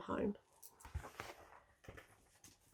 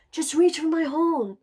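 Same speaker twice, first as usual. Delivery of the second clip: high, loud magical voice; sea unicorn; 8 semitones higher, 9 dB louder than usual. A narrator's reading. Sea unicorn calling to Wilf to grab her horn, high and loud. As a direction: call up with rescuing kindness. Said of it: "she called".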